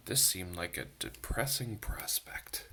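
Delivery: hushed, gloomy whisper; whispered gloom. A narrator's reading. Reading the sad news to children in a whisper.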